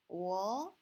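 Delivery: loud "wo" sliding upward; second tone. Shown as a demonstration. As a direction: raise "wo" steadily like a question. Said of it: "one, two, three".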